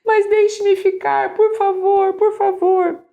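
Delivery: put-on high, loud pleading child's voice, near tears; crying child. Son begs his father to stay at the park, high tearful pleading. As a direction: beg like a sobbing little boy. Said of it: "desfaz-se imediatamente em lágrimas".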